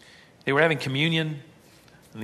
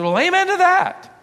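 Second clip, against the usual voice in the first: sarcastic amen; 7 dB louder than usual. Retold or imitated. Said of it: "I said".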